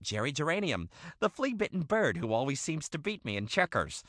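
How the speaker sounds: normal talking voice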